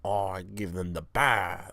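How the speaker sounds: slow second stab at French; drawn-out, badly faked French accent; attempted French accent. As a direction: drag it out in botched French. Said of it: "I could do a French accent".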